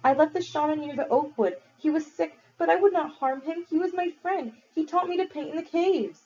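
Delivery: put-on high, earnest boy voice; pleading boy. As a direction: plead innocence, pitched high.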